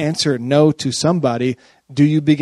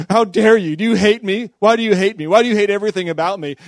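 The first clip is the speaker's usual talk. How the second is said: higher, louder, hammed-up hurt accusation; mock outrage; 6 semitones higher, 4 dB louder than usual. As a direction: overreact like someone offended. Acted offended overreaction.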